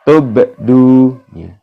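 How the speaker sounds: "tubdu" drawn slow with bouncing b; Quranic recitation. Shown as a demonstration. Teacher demonstrating qalqalah in "tubdu". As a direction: recite "tubdu" slowly, echoing the b.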